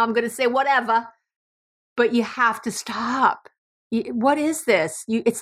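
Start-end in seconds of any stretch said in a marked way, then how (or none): 0.0-3.5 a quick, light New York accent on whatever. Shown as a demonstration. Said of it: "my New York is gonna come out now".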